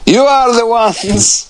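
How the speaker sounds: goofy slow quote voice. Retold or imitated. Quoting the film line slowly.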